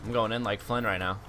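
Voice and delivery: ordinary talk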